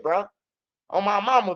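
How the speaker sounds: normal talking voice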